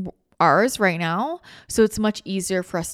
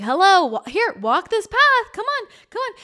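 beckoning spirits; 10 semitones higher, 8 dB louder than usual. Retold or imitated. Spirits call to her to walk this path, high and coaxing. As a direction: lure her down the path, rising higher and higher.

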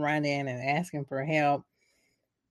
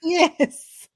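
ordinary delivery

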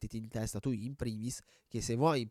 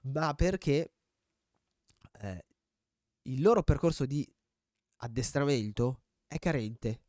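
blocked nose; 2 semitones higher, 3 dB louder than usual. Real, not acted. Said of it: "quanto ho il naso tappato".

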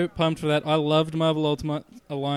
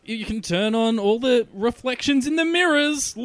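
high, drawn-out hype building to a loud "Look!"; mock excited advertiser; 8 semitones higher, 3 dB louder than usual. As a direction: oversell it like an over-the-top trailer pitch. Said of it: "And they're like".

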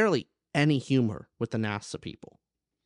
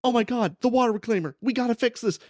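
much higher, mock-panicked cry over the alarms; hammed-up freak-out, pitched way up; panicked NASA; 10 semitones higher, 3 dB louder, faster than usual. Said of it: "nasa's freaking out, going".

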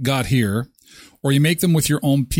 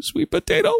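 squeaky sing-song, much higher; sweet potato called out; faster than usual. Acted. Host spotting sweet potato fries, squealing in delight. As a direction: call to the fries like a delighted child.

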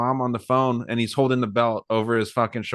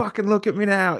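mock triumphant yell; 9 semitones higher than usual. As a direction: crow it at mom like the winner. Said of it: "he's like".

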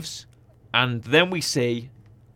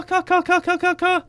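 lightsaber sound effect. Retold or imitated. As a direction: mimic clashing blades with your voice. Imitates lightsaber hits.

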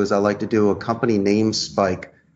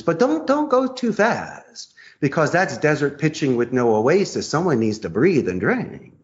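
ordinary talk

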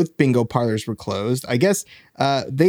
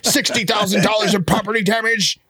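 gruff barking police captain; 5 semitones higher than usual. Pretend. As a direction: bark at a rogue cop. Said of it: "Cash, you're a loose cannon".

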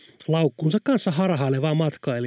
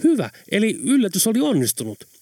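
questioning tone; 6 semitones higher than usual. A narrator's reading. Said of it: "kysyvällä äänellä".

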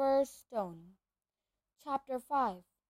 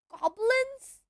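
shocked; 11 semitones higher, 5 dB louder than usual. Acted. Harry shocked.

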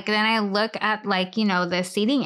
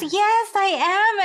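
put-on proud older lady; 10 semitones higher, slower than usual. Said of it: "she goes".

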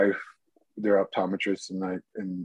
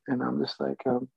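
normal talking voice

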